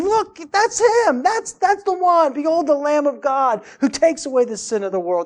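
high, loud, awestruck exclamation; uncontained amazement. Acted. Prophet spotting the awaited Savior and announcing him, amazed exclamation. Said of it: "He can't contain himself".

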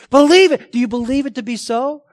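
loud and high, punched out; a sudden preaching shout; forceful exhortation. Real, not acted. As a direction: command them to believe.

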